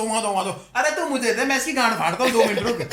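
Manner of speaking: loud, high, comic fury; raging demand. Retold or imitated. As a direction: demand the phone back in a mock rage. Demands the headset back, raging. (गुस्से में phone छीनने की ऊँची नकल)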